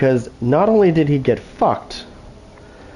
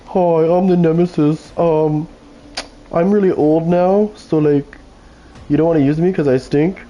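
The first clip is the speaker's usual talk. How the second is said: put-on a lightly raised, self-pitying voice for the old skin; old Nemesis voice; 5 semitones higher than usual. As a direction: play the outdated skin whining about itself, a bit higher.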